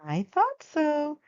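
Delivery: put-on slow, high, knowing old woman; with a smile. Old woman pleased with the girl's confession, smiling. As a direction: smile through the words. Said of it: "said with a smile".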